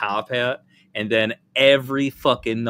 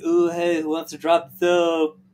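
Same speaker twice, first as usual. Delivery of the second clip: high, flirty mock voice, a touch louder; campy prison voice; 6 semitones higher, 4 dB louder than usual. Mocking [name] in prison, higher flirty voice. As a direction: ham up a flirty inmate offering. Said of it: "I'll never do that voice again".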